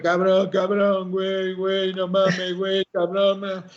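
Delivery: groserías arrastradas y lentas, medio en broma; imitando al majadero. Retold or imitated. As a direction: imita con flojera a quien solo dice groserías. Imita a gente que solo dice groserías. (imitación ligera de alguien que repite groserías)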